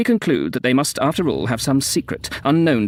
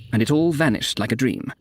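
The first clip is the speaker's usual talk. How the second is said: ordinary delivery, nothing marked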